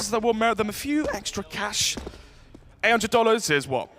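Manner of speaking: usual speaking voice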